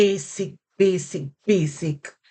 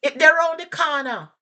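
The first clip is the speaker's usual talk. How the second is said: Jamaican patois; 9 semitones higher, 3 dB louder, faster than usual. Shown as a demonstration. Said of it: "if they said to you".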